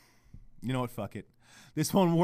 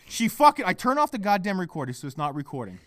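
ordinary talk, nothing marked

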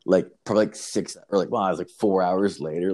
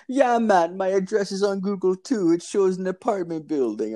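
put-on Jamaican accent; 11 semitones higher than usual. Dealer inviting him up to the third floor, high Jamaican voice. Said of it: "It's, like, a Jamaican dude".

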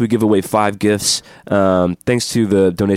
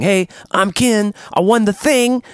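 higher, lightly put-on listener voice; imitating a winner; 9 semitones higher than usual. A prize winner emailing the hosts for his prize, higher voice. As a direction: play a polite eager fan.

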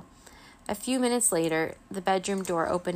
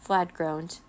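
usual speaking voice

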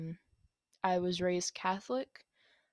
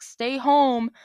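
emphatic; 6 semitones higher, 13 dB louder than usual. Real, not acted. Signing off to viewers.

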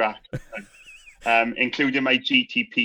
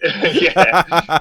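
laughing loud yeah; laughing through the word; 7 semitones higher, 10 dB louder than usual. Real, not acted. Laughing at the host's joke.

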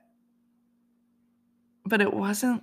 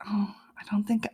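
whispered nurse quote; 4 semitones higher than usual. Retold or imitated. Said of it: "she's like".

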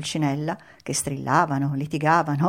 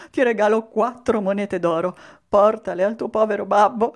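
higher, louder voice between sneezes; softened puppet master; 4 semitones higher, 5 dB louder than usual. A narrator's reading. Mangiafuoco gifting coins to Pinocchio, building toward a sneeze. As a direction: push the words out before the sneeze.

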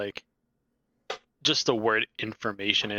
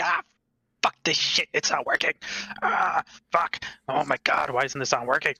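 raised cursing from inside the house; loud frustrated cursing; 4 semitones higher than usual. Acted. Old man swearing at a broken thing, raised voice. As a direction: curse loudly at something that won't work. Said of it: "you hear rumbling in the house, like, loud".

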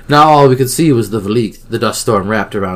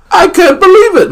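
very high, loud excitement; elated disbelief; 9 dB louder than usual. A narrator's reading. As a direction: squeal it in happy disbelief. Characters celebrate victory.

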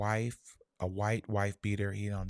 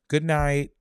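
Jamaican greeting; 6 semitones higher, 11 dB louder than usual. Retold or imitated. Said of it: "you be like".

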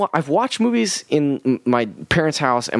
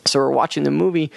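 normal talking voice

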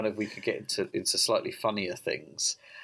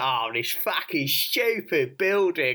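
put-on cockney accent; 8 semitones higher, 5 dB louder than usual. Guard in Baltimore grumbling in a cockney accent. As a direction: do a mock cockney guard moaning about the building. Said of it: "he's slightly cockney".

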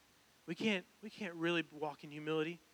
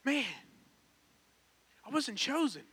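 recalled letdown, pitched higher; sinking feeling; 6 semitones higher than usual. Retold or imitated. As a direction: relive being passed over. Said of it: "this just sinking feeling coming in".

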